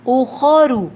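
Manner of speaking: اخرو with full rounded heavy خو; heavy khu. Shown as a demonstration. Teacher correcting students, heavy khu. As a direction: say خو full-mouthed. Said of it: "اخرو نہیں".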